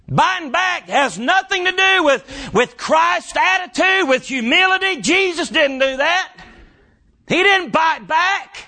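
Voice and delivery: shouted, high preaching cadence; preaching at full force. Acted. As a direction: lift it into a full-throated sermon shout.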